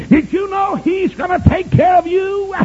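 high, loud revival preacher; high-pitched pulpit cry; full-force preaching. Acted.

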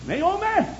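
high, hollered summons; high-pitched name call. Retold or imitated. God calls Naomi by name.

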